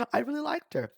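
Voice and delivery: ordinary delivery, nothing marked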